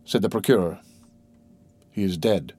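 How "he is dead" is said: low, subdued statement of a death; grave announcement. A narrator's reading. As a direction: break the bad news quietly.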